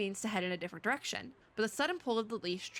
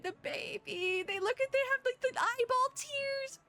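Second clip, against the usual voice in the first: tearful gush; 11 semitones higher than usual. Real, not acted. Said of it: "I'm gonna cry".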